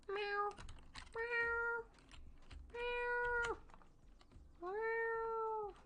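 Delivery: high soft kitten meow; playful high mewing; cat meow. Pretend. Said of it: "I just like meowing like a cat".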